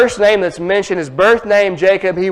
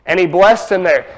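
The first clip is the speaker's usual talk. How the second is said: ordinary talk, nothing marked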